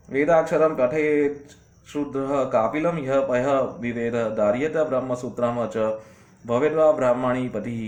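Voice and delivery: slow, deliberate scriptural recitation; Sanskrit verse recitation. Acted. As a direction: recite the verse slowly, drawing out the syllables. (ધીમેથી સંસ્કૃત શ્લોકનું પઠન)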